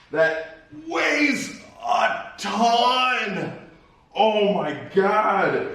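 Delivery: slow strained words while lifting; straining under heavy load. Real, not acted. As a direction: push the words out while hauling. Straining to lift tabletop.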